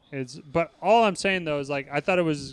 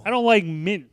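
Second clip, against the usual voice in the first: exaggerated Chicago accent; 4 semitones higher, 4 dB louder than usual. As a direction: lean hard into the Chicago vowels. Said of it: "Really accentuate the teeth".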